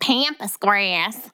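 very slow, drawn-out mock Southern twang; exaggerated twangy accent. Co-host mocking host's pronunciation, exaggerated Southern twang. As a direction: stretch both vowels in a hammed-up Southern drawl. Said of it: "it sounds like you're like".

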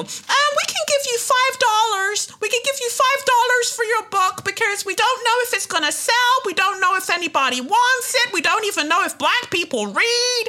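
sarcastic high impression of a dismissive publisher; condescending publisher mock. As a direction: sneer it out as a fake-polite gatekeeper. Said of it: "they'll want a book deal".

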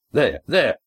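sure, insistent call with a slight lift; certain. A narrator's reading. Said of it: "Cam's voice was certain".

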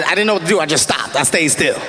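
usual speaking voice

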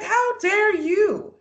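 slow, high, offended how dare you; slowly drawn out, hurt and indignant. Retold or imitated. Retelling Haley's hurt reaction to Jacob, drawn-out indignation. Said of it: "Haley is so genuinely hurt by this".

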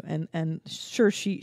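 ordinary talk, nothing marked

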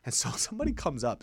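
ordinary talk, nothing marked

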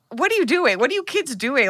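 high, incredulous scolding nurse; exasperated nurse. Retold or imitated. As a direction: scold the kids as the baffled nurse. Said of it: "the nurse is like".

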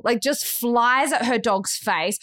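ordinary delivery, nothing marked

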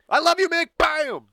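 high, loud adoring holler into a BAM; mock fan yell. Fan punching his idol Mick, high mock yell. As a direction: scream devotion, then shout the hit.

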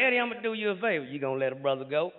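low, soft, fast cop voice; a cop teasing with a fake favor; sly cop. Low soft cop teasing the drunk comedian.

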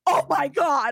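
shocked. Retold or imitated. Club-goer hearing the bouncer's news, shocked. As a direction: replay the shock at full volume.

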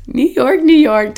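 high, loud cheery New York chant; rally the tired group playfully; excited. Retold or imitated. Cheering cold friends while walking.

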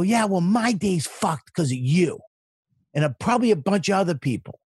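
retold road rage shout. Telling off the honking driver at the light, rising to a furious shout. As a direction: vent at him like you finally snapped. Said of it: "I just snapped".

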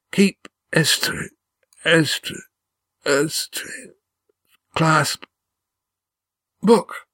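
high, halting, choked single words; struggling for breath. A narrator's reading. Dying Gar trying to name something to keep, struggling for breath. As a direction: strain for each word, breaking off between. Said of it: "his breath bubbling in his throat as he tried to talk".

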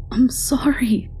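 high, slow, breathy sorry on the edge of crying; near tears. A narrator's reading. Girl near tears apologizing to Stanley, tearful. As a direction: hold back tears as you apologize. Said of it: "I was near tears".

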